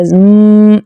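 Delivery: زم held as a nasal hum; ghunna. Shown as a demonstration. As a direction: isolate the humming m in زم. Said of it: "یہ والا sound غنہ sound ہے".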